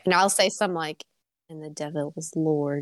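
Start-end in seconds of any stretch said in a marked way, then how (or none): 1.5-2.8 lightly voiced demonic preacher; creepy voice. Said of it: "a creepy ass voice".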